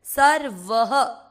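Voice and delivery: सर्वः said slowly, r kept short, ending in ha; don't stretch the r in सर्वः. Shown as a demonstration. Teacher slowly shows students the short r in सर्वः. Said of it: "र को नहीं खींच रहे हैं".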